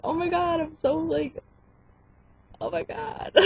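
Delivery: high-pitched gushing through a smile, fading; smiling gush. Shown as a demonstration. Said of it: "Smiling is the biggest thing you can do".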